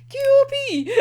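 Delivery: high, loud excited cry; excited exclamation. Real, not acted. Fan crying out in glee at the scene, high and loud. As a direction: blurt it out in glee.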